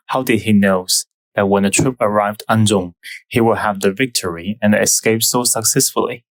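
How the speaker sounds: usual speaking voice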